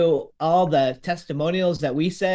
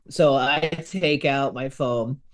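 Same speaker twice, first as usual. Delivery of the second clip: ordinary talk, nothing marked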